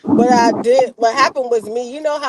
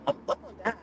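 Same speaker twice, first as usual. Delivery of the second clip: tearful fear; 9 semitones higher, 14 dB quieter, much faster than usual. Retold or imitated. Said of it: "I started crying one day".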